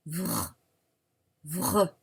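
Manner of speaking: vr said low and buzzing; consonant cluster. Shown as a demonstration. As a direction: sound out vr for dictation. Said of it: "Écrivez".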